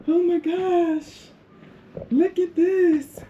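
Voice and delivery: high, slow, drawn-out marvelling; awed delight. Real, not acted. Traveler showing off her bathroom, thrilled and awed. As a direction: stretch the words out high in wonder.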